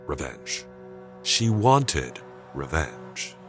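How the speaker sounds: ordinary delivery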